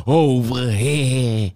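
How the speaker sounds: drawn-out New York "over here"; New York accent. Retold or imitated. Imitating old school friends who talk like that, in a New York accent. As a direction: stretch it slowly in a thick New York accent. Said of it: "they do say things like".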